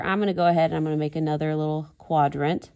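normal talking voice

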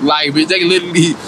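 normal talking voice

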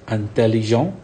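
Intelligent said in French with the final t silent and a nasal end. Shown as a demonstration. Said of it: "So the T is silent here".

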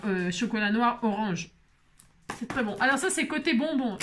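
usual speaking voice

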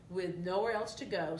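ordinary delivery

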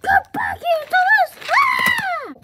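high-pitched play yell; calling after. Pretend. Toy pursuer calling back runaway Thomas, urgent yell. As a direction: call high, rising into a cry.

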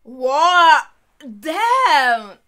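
very loud, drawn-out amazement; shocked outcry. Real, not acted. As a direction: belt it out in stunned awe. Shocked reaction to the show.

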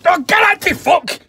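loud, rough bellow at a mate; slowed, shouted taunt; rowdy shout. Real, not acted. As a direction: roar it across the room, goading. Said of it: "You must be fucking raging".

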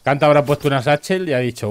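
ordinary delivery

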